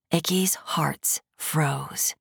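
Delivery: hushed, slow whisper. A narrator's reading. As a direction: breathe it out in a whisper. Narrator drops to whisper.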